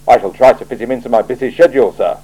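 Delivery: quick dry quip to the boss; Commander Vimes. Acted. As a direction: brush it off briskly.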